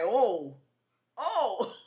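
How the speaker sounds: loud mock pained grunts; imitated grunting. Woman imitating him grunting on massage table, mock pained grunts. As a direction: grunt as if being pummeled, imitating. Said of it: "grunting, like".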